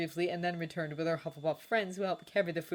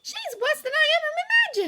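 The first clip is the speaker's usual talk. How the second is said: put-on much higher, louder indignant girl; angry Hermione; 9 dB louder, slower than usual. A narrator's reading. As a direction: spit out the outrage. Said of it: "said Hermione angrily".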